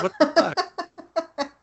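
slow, high, laughing what the fuck; laughing disbelief. Acted. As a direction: drag it out while laughing. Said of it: "It was just".

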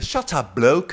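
put-on high British-accented retort, loud last word; mock British English. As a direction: pose as a Brit telling someone off. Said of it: "että mä olin britti".

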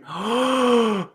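high squeaky inhale gasp; tight gasp. Shown as a demonstration. Teacher showing the wrong breath: squeaky gasp. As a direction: gasp in through tight cords. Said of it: "if you're making a ... type of sound".

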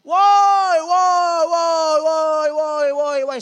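long, high echoing holler; shouting. Acted. Yelling from a mountain, drawn-out high shout. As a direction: call out across the mountains, very loud. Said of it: "saudara teriak".

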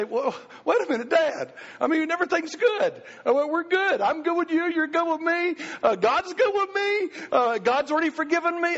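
a daughter wheedling her way out; pleading little girl. Retold or imitated. Daughter pleads to escape spanking.

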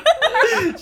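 carcajada fuerte y aguda. Real, not acted. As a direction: risa que explota de golpe. Risa estallando en la charla. (carcajada aguda)

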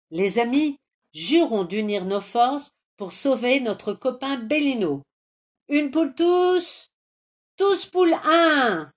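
high, loud, rousing pledge; heroic oath. Acted. Hero rallies friends to save Bellino, rousing oath. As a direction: swear it like musketeers, slowing at the end.

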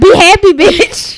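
loud, high comic command; mock bossy yell. Quoting friend's order to a future groom, mock yell. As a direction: belt it out as a joking order.

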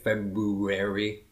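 "February" slowly, every syllable sounded; over-articulate each syllable of "February"; purposeful pronunciation. Shown as a demonstration. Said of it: "I purposely try to".

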